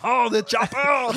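put-on drawn-out shouted action-hero Austrian accent; hammed-up Austrian yell; Arnold Schwarzenegger. Teased about his accent, plays up the Arnold Schwarzenegger voice. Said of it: "a little Arnold Schwarzenegger".

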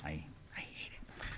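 hushed, voiceless whisper; very soft, whispered confession; whispered dread. Real, not acted. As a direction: let it die away into a whisper. Confessing dread of loud storms.

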